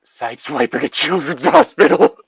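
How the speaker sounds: breaking into a laugh mid-word; can't hold back the giggle on hospital; laughing through it. Real, not acted. Laughing at own dark joke.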